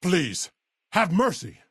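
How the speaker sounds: high-pitched plea for mercy; pleading. Acted. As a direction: beg to be spared. Begging for life.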